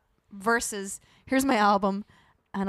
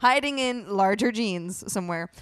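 mock excited; 3 semitones higher, 9 dB louder, slower than usual. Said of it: "and you were like".

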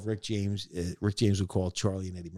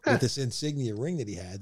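usual speaking voice

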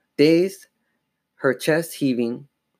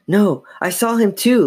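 high, louder insistence; emphatic protest; 9 semitones higher, 5 dB louder than usual. A narrator's reading. Sister coming to Chris's aid, emphatic. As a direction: jump in to back someone up.

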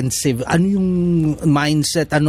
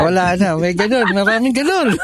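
higher-pitched words breaking into laughter; laughing; 7 semitones higher than usual. Real, not acted. Co-host agreeing that many trainees leave, laughing. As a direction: laugh while agreeing knowingly.

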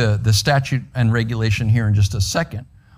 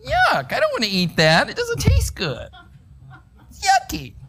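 put-on very high, childish whine pulling away from food; squeaky little-kid complaint; whiny child. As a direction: play a picky kid refusing dinner. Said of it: "Kids, have you ever been like that?".

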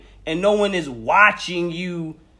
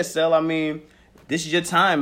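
normal talking voice

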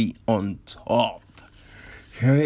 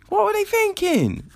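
incredulous high squeal. Real, not acted. Incredulous high-pitched question.

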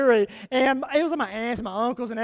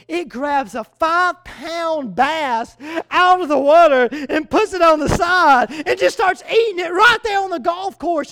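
excited storytelling; 4 semitones higher, 6 dB louder than usual. Retold or imitated. Preacher telling the congregation an eagle story, rising excitement. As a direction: tell it like you still can't believe it.

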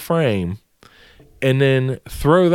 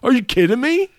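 outraged; 11 semitones higher than usual. Retold or imitated. Still outraged at HR.

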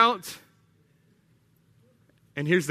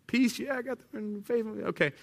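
higher, soft muttered ticking-off; mock checklist self-approval; 5 semitones higher, 5 dB quieter than usual. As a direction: play someone breezing through a checklist. Acts out hurried checklist.

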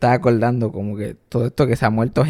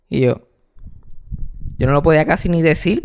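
ordinary delivery

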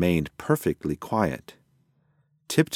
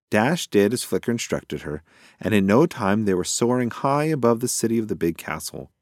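ordinary delivery, nothing marked

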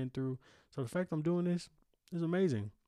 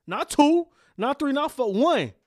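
counting off each number louder and higher, almost shouting; loud rant; 9 semitones higher, 10 dB louder, slower than usual. Real, not acted. Podcaster bragging to doubters that he started solo, shouting. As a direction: hammer home that it was just you. Ranting about doing it alone.

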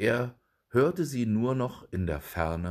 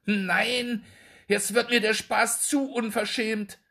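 indignant call; 12 semitones higher than usual. A narrator's reading. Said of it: "rief Hanne ihrer Nachbarin zu".

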